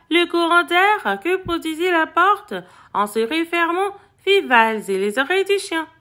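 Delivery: ordinary delivery, nothing marked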